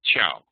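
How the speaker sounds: "ciao" said as chow; correct ch sound. Shown as a demonstration. Teacher correcting the wrong versions, ch sound said as chow. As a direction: land on "ciao" with a ch as in reach. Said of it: "C I A O is pronounced ciao".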